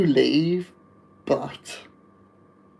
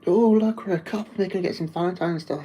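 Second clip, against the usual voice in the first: mocking smug couple; 5 semitones higher than usual. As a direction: rattle it off like a pleased-with-itself couple. Mocking a couple buying Valentine's.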